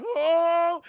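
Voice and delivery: loud, high shocked oh; mock shock. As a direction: gasp it out in shock. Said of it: "He's like".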